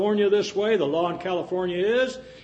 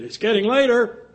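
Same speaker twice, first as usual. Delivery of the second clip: loud, high call across the church; mock loud announcement; 7 semitones higher, 4 dB louder than usual. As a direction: call it out to the whole room. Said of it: "tell everybody as loud as she could".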